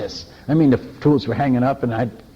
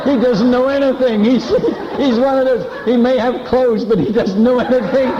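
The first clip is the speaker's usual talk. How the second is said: mocking laughter; 9 semitones higher, 4 dB louder than usual. Villager mocking the visitor, crowd laughing.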